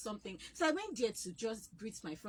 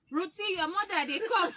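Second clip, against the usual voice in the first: called out loud and high across the neighbourhood; half-laughing yell, like kids spotting her mom; shouting out; 8 semitones higher, 6 dB louder than usual. Retold or imitated. Children warning Ruthie her mother is coming, shouted laughingly. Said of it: "they start shouting".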